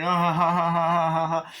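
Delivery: hammed-up mock laugh; imitated laughter. Character laughing at the doll's excuse, long mock laugh.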